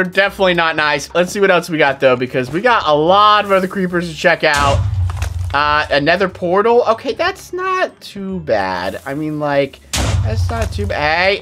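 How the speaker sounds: normal talking voice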